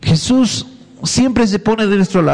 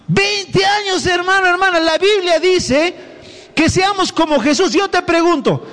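preaching rebuke; 10 semitones higher than usual. Real, not acted. Preacher scolding long-time believers, high and insistent. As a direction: lift the voice high and press each word.

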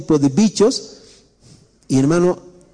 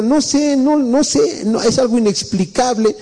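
much higher, whiny plaintive woman; mock complaining wife; 6 semitones higher than usual. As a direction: whine it like a flustered churchgoer. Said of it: "Pastor, tenemos problemas con mi esposo". (imitando a una hermana quejosa, voz aguda)

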